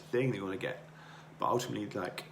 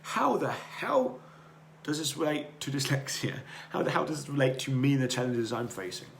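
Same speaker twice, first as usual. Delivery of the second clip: mock-outraged asker, higher and louder; imagined skeptical listener; 4 semitones higher, 4 dB louder than usual. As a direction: throw the question back like a doubter. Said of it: "you might ask me".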